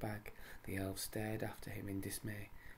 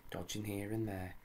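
plain reading voice

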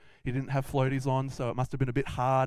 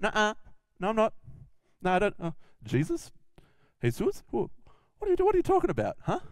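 high-pitched defensive denial; feigned ignorance; 7 semitones higher, faster than usual. Acted. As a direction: play dumb, pitch jumping up in protest. Peter acts out denying Jesus.

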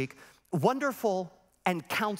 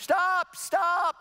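high, raised mock shout; imitated urgent high call; calling a halt; 10 semitones higher, 4 dB louder than usual. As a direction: cut in like a professor stopping rehearsal. Professor halts the rehearsal.

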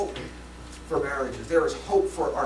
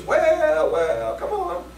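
high, excited "well, come on"; church ladies calling; 13 semitones higher, 5 dB louder, much slower than usual. Retold or imitated. Church ladies urging the preacher, high excited calls. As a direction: cheer him on like the church ladies. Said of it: "And ladies".

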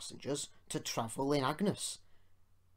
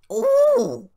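very high, loud shriek of outrage; piercing scandalised squeal; shocked scream; 17 dB louder than usual. A narrator's reading. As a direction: shriek a long "oh". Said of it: "screamed Agnes".

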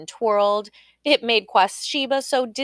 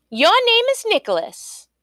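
very high, louder, ringing answer; quick confident guess; 13 semitones higher, 5 dB louder than usual. A narrator's reading. Queen answering right back, high and loud.

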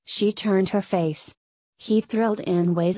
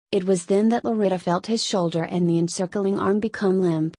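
ordinary delivery, nothing marked

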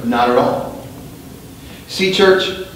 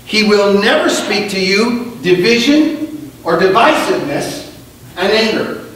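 high, intense preacher's cry; rising preaching; 10 semitones higher than usual. Real, not acted. Pastor warning the church against divisive voices, fiery preaching. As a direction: build it up and let it ring.

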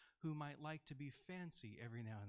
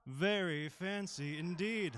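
louder, slow, grand posh gentleman; hammed-up posh toff; fancy posh voice; 4 semitones higher, 9 dB louder than usual. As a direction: play the fancy aristocrat. Children's sermon, dressed up in costume.

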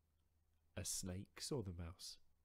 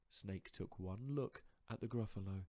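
ordinary delivery, nothing marked

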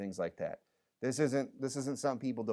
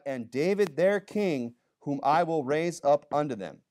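louder, declaiming reading of the King James text; scripture reading; 3 semitones higher, 5 dB louder than usual. Acted. Pastor reading Jeremiah to his church, declaiming. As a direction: proclaim the verse to the room.

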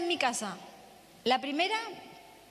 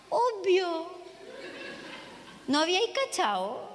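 put-on teen daughter; 4 semitones higher, slower than usual. Daughter pitying mother.

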